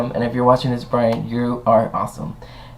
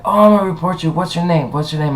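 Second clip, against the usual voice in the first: mocking angry guest; 5 semitones higher than usual.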